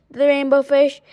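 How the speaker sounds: usual speaking voice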